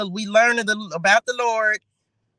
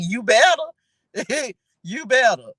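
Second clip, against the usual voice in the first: loud, sassy "You better"; sassy warning; 3 semitones higher, 5 dB louder than usual. Real, not acted. Host warning Esau on Jacob's behalf, sassy and loud. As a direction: tease the brother with attitude.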